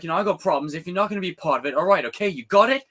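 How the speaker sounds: a lightly voiced Miyagi storming off; Miyagi impression. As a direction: play the hurt old sensei. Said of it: "that scene where he's like".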